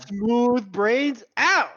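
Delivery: sign-off shout. Acted. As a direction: stretch it out like a show's sign-off. Podcast sign-off catchphrase.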